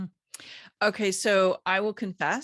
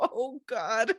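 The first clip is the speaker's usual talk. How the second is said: higher-pitched imitation of dismayed clients; mock dismay; 5 semitones higher than usual. Coach imitating clients facing tenfold prices, mock dismayed. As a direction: give a playful groan of dread.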